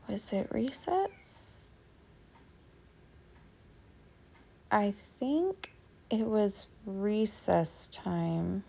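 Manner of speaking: slow, soft, drawn-out recollection; pondering aloud. Real, not acted. Vlogger trying to remember whether it was recess, pondering slowly. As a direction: think aloud softly, stretching the words.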